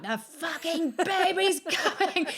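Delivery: high, slow, laughing outburst; laughing exasperation. Retold or imitated. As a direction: drag it out through a laugh.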